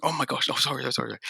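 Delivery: high, hurried mock-flustered apology; apologetic fluster, pitched well up. Re-enacts apologising to son he woke.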